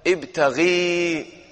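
teacher modelling ابتغي slowly; slow demo. Shown as a demonstration. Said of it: "طيب".

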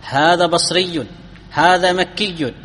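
slow بصري and مكي, each ending in -iyyun; case ending sounded. Shown as a demonstration. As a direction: draw out بصري and مكي with a doubled ya and final -un. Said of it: "ظهرت الحركة". (نطق بصري ومكي ببطء بياء مشددة وتنوين ضم)

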